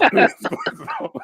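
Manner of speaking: high-pitched squealing laugh; a sudden high laugh that breaks out; burst of laughter. Real, not acted. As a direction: crack up helplessly.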